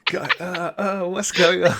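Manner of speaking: high, confused groaning voice; hammed-up high, disoriented voice; dazed injured man. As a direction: groan it out, bewildered and hurt. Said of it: "and he's like".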